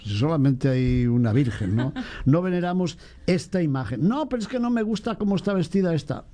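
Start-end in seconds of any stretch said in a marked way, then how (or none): 4.1-6.3 higher, whiny imitation; mock the picky believer; mock fussy devotee. Priest mimicking a choosy devotee, whiny.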